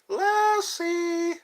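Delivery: high shrill calls of "Auntie M!"; old movie cry. Pretend. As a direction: cry out like Dorothy in the storm. Or Lassie.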